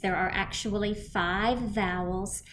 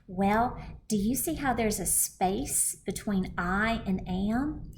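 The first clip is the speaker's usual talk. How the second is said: ordinary delivery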